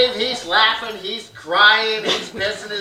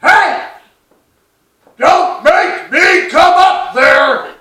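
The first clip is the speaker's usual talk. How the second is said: loud, high, slow bellow up the stairs; mock angry dad shout from downstairs; dad yelling upstairs; 9 semitones higher, 8 dB louder, much slower than usual. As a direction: roar it up at the kids from the chair. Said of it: "he yells".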